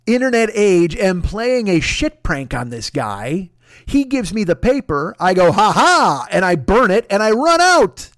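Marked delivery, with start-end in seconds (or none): 5.5-6.3 loud, triumphant "ha ha"; mock gloating laugh. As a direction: crow it like a victorious prankster. Mimes prankster's gloating laugh.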